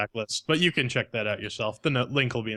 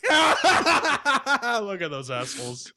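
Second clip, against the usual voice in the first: laughing mock voice; 10 semitones higher, 4 dB louder, much slower than usual. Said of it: "They're just like".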